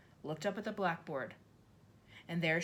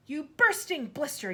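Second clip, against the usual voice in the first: higher, louder yell; 9 semitones higher, 8 dB louder than usual. A narrator's reading. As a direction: hurl the insult. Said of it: "yelled the Trunchbull".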